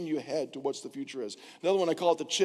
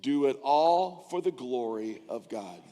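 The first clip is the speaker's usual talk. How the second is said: slow, weighty reading of a verse; slow scripture reading; 4 semitones lower, slower than usual. Shown as a demonstration. Pastor leading the church in a verse, slow and weighty. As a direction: read it slowly so they can follow. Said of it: "read this with me".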